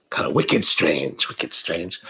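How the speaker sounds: low, slow New England drawl; a hint of New England accent, dropped low; mock New England. Teasing New England viewers about being strange, mock New England accent.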